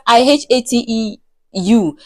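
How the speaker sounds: plain reading voice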